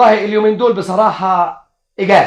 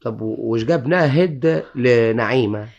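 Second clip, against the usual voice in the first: slow mocking; 5 semitones lower, 3 dB quieter, slower than usual. Husband mocks fancy name.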